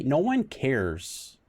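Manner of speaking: drawn out, stress on one; slow emphatic. Real, not acted. Streamer dismissing wedding posts, slow and emphatic. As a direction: spell it out slowly, no one cares. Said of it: "slow emphatic dismissal".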